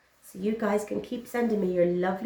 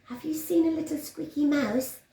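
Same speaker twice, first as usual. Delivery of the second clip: lightly voiced cat, a touch higher; a sly cat asking around; 9 semitones higher than usual. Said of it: "said the cat".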